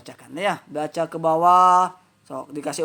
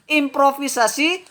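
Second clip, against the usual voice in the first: reading a definition aloud; 5 semitones higher, 4 dB louder than usual. Shown as a demonstration. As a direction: read the definition out, higher and louder. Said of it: "Saya bacakan". (membacakan definisi dengan lantang)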